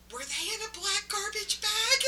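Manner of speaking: high loud call across the house; yelling from another room. Retold or imitated. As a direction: shout it to the next room. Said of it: "I just yelled from the other room".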